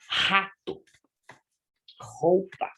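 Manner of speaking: "rato" and "roupa" opened on a throaty h-like R; isolated words with guttural initial R; strong initial R. Shown as a demonstration. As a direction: rasp the first R of "rato", then "roupa". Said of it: "ela já é forte".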